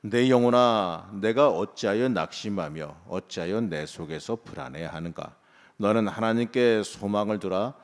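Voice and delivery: measured, declaimed Bible verse; scripture read-aloud. Real, not acted. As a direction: read out scripture for all to join. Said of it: "함께 합독하시겠습니다".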